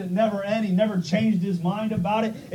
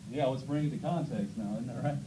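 normal talking voice

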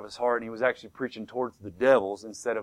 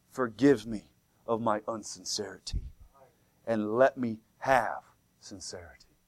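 softer, lower retold plea to God; humble prayer; 5 semitones lower, 5 dB quieter than usual. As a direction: pray it quietly, with pauses between phrases.

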